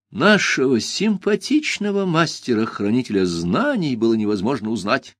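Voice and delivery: plain reading voice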